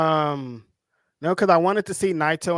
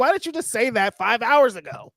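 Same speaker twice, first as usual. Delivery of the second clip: higher-pitched retold complaint; mock exasperation; 6 semitones higher than usual. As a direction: throw it back, acting exasperated. Acted exasperated comeback in quarrel.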